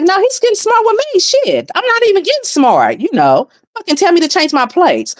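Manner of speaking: high-pitched, quick mock-outraged comeback; replaying an indignant protest; retold indignation. Retold argument over getting smart.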